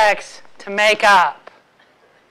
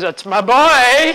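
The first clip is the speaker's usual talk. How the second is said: proud dad; slower than usual. Retold or imitated. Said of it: "he goes".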